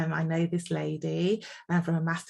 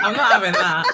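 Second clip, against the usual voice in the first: loud laughing denial, playful; laughing protest; 10 dB louder, faster than usual. Real, not acted. Host laughing off guest's decades joke, playful laughing protest.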